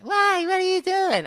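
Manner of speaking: put-on high, whiny girly squeak; mock girl voice. Advising a caller, voicing the girl friend in a high mock girl voice. Acted girl's reply, high voice.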